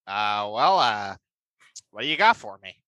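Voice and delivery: low, unhurried studio boss voice; mock Ron Howard. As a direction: play the big producer hearing a pitch. Playing Ron Howard receiving pitch.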